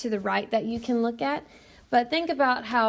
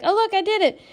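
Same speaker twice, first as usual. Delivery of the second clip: higher, louder mock-excited quote; excited mimicry; 10 semitones higher, 6 dB louder, faster than usual. Someone thinking they beat the finger challenge, mock-excited. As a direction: imitate someone thrilled at their own success. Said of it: "get super excited 'cause they're like".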